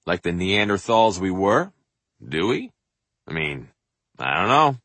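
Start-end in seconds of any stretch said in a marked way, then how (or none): none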